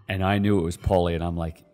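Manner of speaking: ordinary talk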